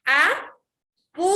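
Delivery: "A, pu" loud and split, voice rising; teacher's exaggerated rising "A, pu"; stress on PU. Shown as a demonstration. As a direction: sound out "A", pause, then push "pu" upward. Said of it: "cuando digo pu estoy subiendo la voz".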